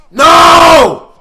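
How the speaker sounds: loud high cry of no; shout. Real, not acted. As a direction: yell it in dismay. Reacts to goal.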